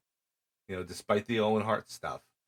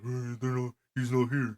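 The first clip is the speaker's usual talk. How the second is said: higher, hesitant mock kid excuse; nervous kid voice; 5 semitones higher than usual. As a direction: fumble the excuse like a caught kid. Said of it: "And he's like".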